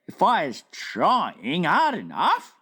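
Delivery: lightly voiced friendly giant, slow wondering question; BFG voice. Giant asking the Queen at breakfast, wondering. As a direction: puzzle it out like a big gentle giant.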